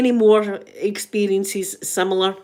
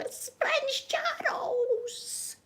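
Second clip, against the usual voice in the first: hushed, slow whisper; slow stage whisper of surprise; 10 dB quieter, much slower than usual. Real, not acted. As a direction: whisper it so the royal won't hear. Spots royalty, hushed aside.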